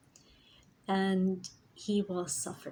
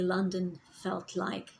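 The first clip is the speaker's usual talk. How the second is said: usual speaking voice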